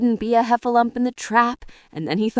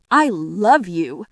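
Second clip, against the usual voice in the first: slow, lower, tender declaration; affectionate; 4 semitones lower, slower than usual. A narrator's reading. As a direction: say it slowly and fondly. Christopher Robin's affection for Pooh.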